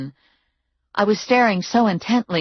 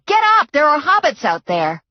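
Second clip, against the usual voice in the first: much higher, loud alarm call; yelling; 10 semitones higher, 5 dB louder than usual. A narrator's reading. Heroine yelling to Muner, loud and alarmed. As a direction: shout it across the room. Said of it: "I yelled to Muner".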